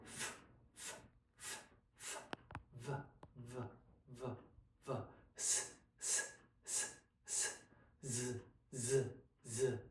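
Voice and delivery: unpitched f, v, s, z, each repeated softly and apart; slow fricative drill. Shown as a demonstration. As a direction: sound out "f", "v", "s", "z" slowly as bare consonants. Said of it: "we're gonna slowly start with".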